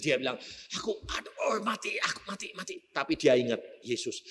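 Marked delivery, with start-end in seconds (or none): 0.8-2.8 softer, breathless panicky cries; the man crushed by the spirit, gasping in fear; mock dying gasps. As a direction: gasp it out as if being squeezed to death. Victim being crushed by spirit.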